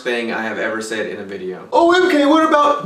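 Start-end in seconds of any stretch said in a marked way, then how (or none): 1.7-2.9 very high, louder, teasing guess; mock viewer voice. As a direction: squeal like an eager viewer.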